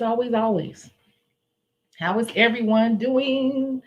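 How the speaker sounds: usual speaking voice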